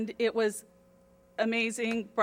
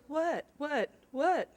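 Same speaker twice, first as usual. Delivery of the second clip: imitating Brian; 3 semitones higher, 4 dB quieter, slower than usual. Boss Brian dodging the bra question, soft feigned innocence. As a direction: play dumb like a caught boss. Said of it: "You know, like that".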